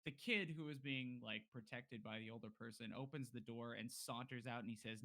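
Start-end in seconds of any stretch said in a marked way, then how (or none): none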